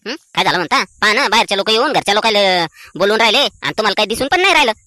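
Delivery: usual speaking voice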